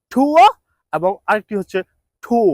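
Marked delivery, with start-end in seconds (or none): 0.1-0.5 ঠুয়া said high and loud; first sound demo. Shown as a demonstration. Teacher demonstrating a sound of tour, loudly. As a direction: bark out the sound to show it. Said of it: "একটি হচ্ছে".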